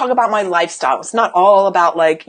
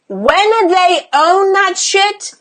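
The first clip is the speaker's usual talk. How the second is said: high, drawn-out, ringing delivery of "When they own that shit"; emphatic build-up; 8 semitones higher, 4 dB louder, much slower than usual. Real, not acted. Coach building to the payoff for clients, emphatic. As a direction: hold each word up high, slowly.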